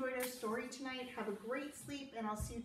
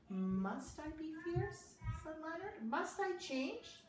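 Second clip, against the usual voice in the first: higher, slow, wondering voice; worried questioning; 7 semitones higher than usual. Acted. Leonard doubting the pack, higher and slow. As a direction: ask it gently, unsure.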